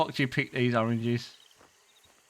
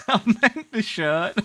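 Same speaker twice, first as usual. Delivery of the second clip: slow, laughing through the words; 6 semitones higher, 4 dB louder, slower than usual. Real, not acted. Player excusing his "very nice" comment, laughing. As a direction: giggle out the excuse.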